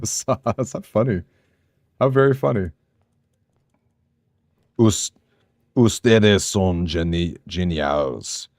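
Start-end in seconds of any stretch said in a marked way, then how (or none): 4.8-8.5 slow, careful put-on Spanish accent; rolling out the Spanish with an accent. Streamer greeting Spanish-speaking fans in a Spanish accent. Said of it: "I feel the need to give an accent".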